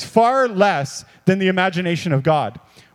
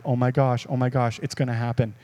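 low, soft dawning excitement; acted inner thought, softly awed; hushed anticipation; 7 semitones lower, 6 dB quieter than usual. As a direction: let the realisation creep in quietly. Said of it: "you're like".

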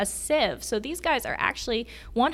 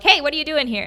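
mock yell, higher and louder; scolding tern; 6 semitones higher, 5 dB louder, faster than usual. Tern scolding a visitor at the ice toilet, a mock yell. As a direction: squawk at the intruder like an angry bird. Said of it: "kinda yell at you".

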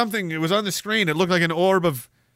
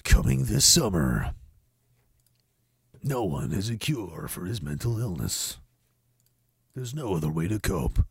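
movie trailer announcer; 7 semitones lower, 6 dB quieter than usual. Acted. Host announcing a film coming this summer, trailer voice. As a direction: drop down low and soft for an ominous trailer.

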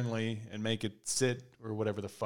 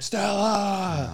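Stella! yelled higher and louder; a loud call of the dog's name; 9 semitones higher, 5 dB louder than usual. Shown as a demonstration. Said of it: "I can't yell at a dog".

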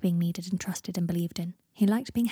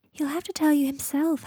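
high, lilting refusal; gentle Luna; 8 semitones higher than usual. A narrator's reading. Luna declining to tell Harry more, gently. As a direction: lift it lightly while standing firm.

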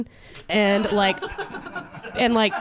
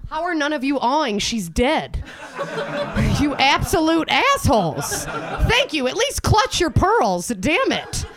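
high, exasperated scolding of the crowd; comic tirade, pitched high; mock outrage; 8 semitones higher than usual. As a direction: berate the audience in mock indignation. Berating audience for not awing.

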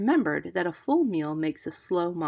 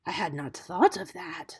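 musing male snake voice; whispering cobra. A narrator's reading. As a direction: ponder it as a plotting cobra. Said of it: "heard Nag and Nagaina whispering together".

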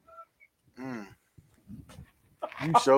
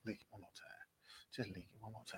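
softer, almost breathless coaxing; whispered teasing coax; near whisper; 7 semitones lower, 27 dB quieter, much faster than usual. Real, not acted. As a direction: fade the request down to a whisper.

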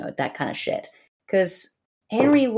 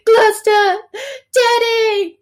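loud, high mock crying; Henry wailing; 6 dB louder than usual. Henry calls for dead Gloucester, loud mock wail. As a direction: bawl it out in hammed-up grief. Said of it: "And Henry's like".

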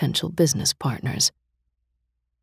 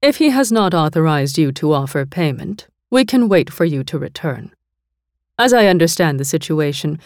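louder, steady, cool rebuttal; unruffled Mistress; 2 semitones higher, 5 dB louder than usual. A narrator's reading. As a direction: calmly call his bluff. Mistress calmly counters threat.

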